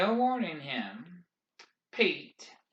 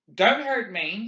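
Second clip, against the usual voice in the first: scream; 6 semitones higher, 8 dB louder than usual. A narrator's reading. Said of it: "someone screamed".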